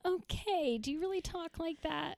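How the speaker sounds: high, mock Midwestern accent; Midwestern accent impression. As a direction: put on a high-pitched, comic Midwestern lilt. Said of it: "all of those accents are a little like".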